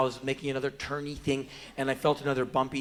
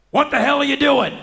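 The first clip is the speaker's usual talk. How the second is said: high, very loud outraged shout; shouting cop; 13 semitones higher, 13 dB louder than usual. Acted. Policeman grabbing a brawler, furious shout. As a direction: bellow it as a furious order to stop. Policeman yells at him during arrest.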